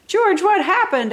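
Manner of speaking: high, lightly voiced surprised child; a kid gaping at the mess; boy bursting into the kitchen; startled boy. A narrator's reading.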